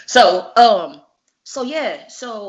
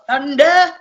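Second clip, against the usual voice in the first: louder, higher, punching the word Thunder; loud thunder call; 9 semitones higher, 10 dB louder, much slower than usual. Retold or imitated. [name] reliving Davey Boy's big slap, loud and punchy. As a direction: boom out Thunder like the hit.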